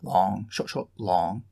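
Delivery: long short syllables chanted with rising tune; scanning the pattern aloud; rhythm recited. Shown as a demonstration. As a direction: chant the metre, leaning on each long. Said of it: "The Adonian".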